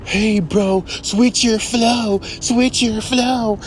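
high, slow, whiny mock of a fan. Rapper mocks dumb fans telling him to switch his flow, high and slow. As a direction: drag it out like a nagging fan. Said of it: "some dumbass fans are gonna say".

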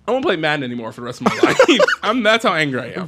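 quick, laughing through the protest; amused, fast mock grumble; laughing indignation. Real, not acted. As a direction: let a laugh break into the angry vow. Laughs through own mock anger.

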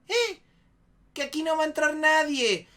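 high, loud mock refusal; mujer atrincherada. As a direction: imitate her yelling back through the door. Mujer atrincherada repite su negativa. (imitando su negativa a gritos)

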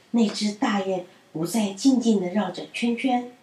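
usual speaking voice

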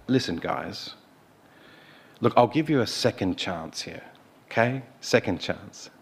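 soft, oily, condescending offer; smarmy and arrogant. Retold or imitated. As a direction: wheedle them like a smug ruler. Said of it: "All smarmy-like and arrogant".

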